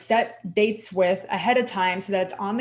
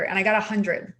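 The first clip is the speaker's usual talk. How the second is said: usual speaking voice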